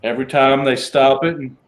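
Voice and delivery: ordinary talk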